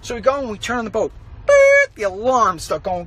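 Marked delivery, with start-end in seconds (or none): 1.4-1.9 loud high beep; imitated alarm beep. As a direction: mimic a shrill alarm. Alarm going off.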